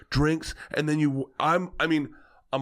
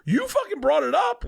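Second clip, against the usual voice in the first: high, half-laughing retort; defensive protest; 14 semitones higher, 3 dB louder than usual. Retold or imitated. Guest answering his confused host, laughing protest. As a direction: throw the blame back, pitched way up.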